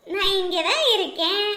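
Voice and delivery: normal talking voice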